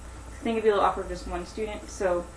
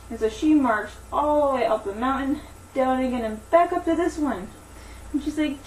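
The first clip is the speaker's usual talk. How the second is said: animated storytelling, higher than chat; story narration; 5 semitones higher, 5 dB louder than usual. Acted. Teacher telling Daisy and the giant to children, animated story narration.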